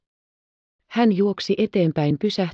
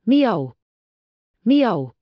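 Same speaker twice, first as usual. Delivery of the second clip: meow; 4 semitones higher than usual. Acted. Heidi's kitten mews.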